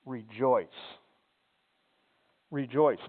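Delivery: each "rejoice" said low and firm, falling at the end; emphatic repetition. Shown as a demonstration. Pastor repeating Paul's command to drive it home, firmly. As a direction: press down on both words "rejoice". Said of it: "really wanna drive the point home".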